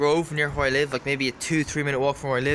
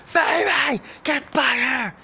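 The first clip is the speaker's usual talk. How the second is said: retold scream; 11 semitones higher, much slower than usual. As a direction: bellow after a runaway greyhound. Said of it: "he screamed at the top of his voice".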